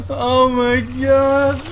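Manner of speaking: high, loud, squealing laughter through the words; helpless laughter, barely getting words out; laughing hard. Real, not acted. Player reacting to the dome going down, laughing hard. Cracking up at a play.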